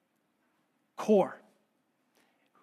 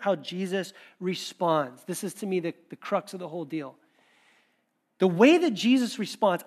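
usual speaking voice

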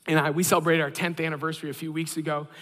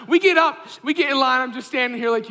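ordinary delivery

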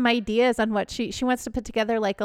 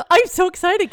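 high, loud, giddy excitement; bubbling over with joy; excited; 7 semitones higher, 6 dB louder than usual. Real, not acted. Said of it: "Can you tell?".

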